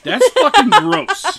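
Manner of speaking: high, slow, laughing groan; laughing disgust. Real, not acted.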